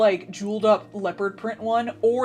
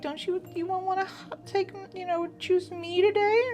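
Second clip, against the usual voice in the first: high, soft, sugary cafe maid; cute maid voice; 9 semitones higher, 7 dB quieter than usual. Pretend. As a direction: coo it like a pushy maid. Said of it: "act, like, really cute".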